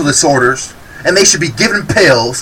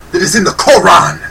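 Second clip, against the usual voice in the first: usual speaking voice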